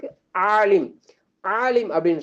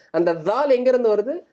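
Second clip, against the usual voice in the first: usual speaking voice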